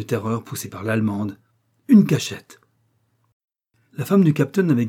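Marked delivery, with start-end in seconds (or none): 1.9-2.6 a sharp, high, loud cry of triumph; wife's triumphant cry on finding the hiding place. Acted. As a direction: shout it out, you've found it. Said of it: "un cri de triomphe".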